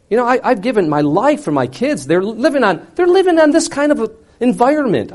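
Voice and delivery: high-pitched exasperated complaint; acted outburst of self-pity; fed-up rant. Acted fed-up rant about life.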